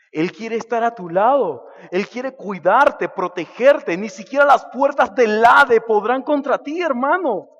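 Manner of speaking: builds from strong to a soaring, loud cry; rising preaching shout. Real, not acted. Preacher crying out to his brothers, loud and rising.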